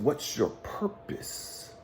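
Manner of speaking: slow, whispered and soft; hushed, slow mentor's question; hushed probing question. Retold or imitated. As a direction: ask it slowly under your breath, searching. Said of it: "And then he said".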